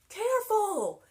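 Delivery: very high, very loud warning; alarmed shout. Acted. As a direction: yell a frantic warning. Jack warns as buggy races.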